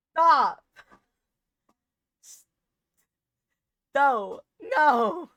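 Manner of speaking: loud, high shout of dismay; frustrated shout. Real, not acted. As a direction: yell at the screen as it fails. Game catch fails.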